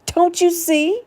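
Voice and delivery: high, anguished plea; begging him to understand, crying. A narrator's reading. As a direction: cry it out to him. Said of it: "she cried".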